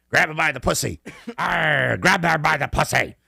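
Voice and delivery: high, loud hammed-up pirate growl. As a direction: ham it up like a drunk pirate. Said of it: "the pirate scene".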